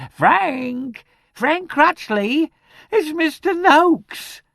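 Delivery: very high, drawn-out screeching cry; banshee wail. A narrator's reading. Charwoman calling out the terrible news, banshee wail. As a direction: wail it out slowly at the top of the voice. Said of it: "like the wail of a banshee".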